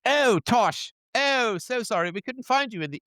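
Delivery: high-pitched, put-on British apology; mock British accent, high and breezily apologetic. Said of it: "maybe with a British accent".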